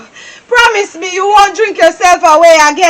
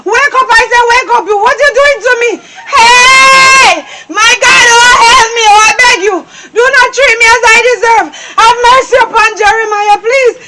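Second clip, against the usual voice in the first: wailing plea; 3 semitones higher than usual. Acted. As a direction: wail and beg through tears. Wife wailing over unconscious husband.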